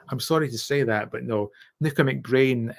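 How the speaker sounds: ordinary talk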